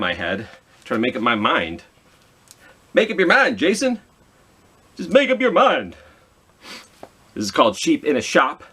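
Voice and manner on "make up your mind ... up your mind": mock self-scolding. Scolding herself playfully.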